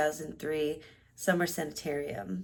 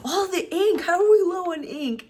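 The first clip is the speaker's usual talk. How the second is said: high, loud exasperated mom voice; mom's ink complaint; 12 semitones higher, 8 dB louder than usual. Retold or imitated. Mom discovering the printer ink ran out, high exasperated voice. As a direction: play the frazzled mom who finds the ink gone.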